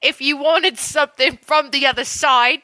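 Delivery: amateur British witness account; British accent. Acted. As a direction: narrate in a put-on British accent. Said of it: "just do it in an accent".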